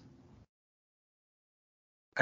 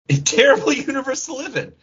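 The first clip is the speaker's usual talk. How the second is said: laughing; 11 semitones higher, 3 dB louder, faster than usual. Real, not acted. Laughing aside.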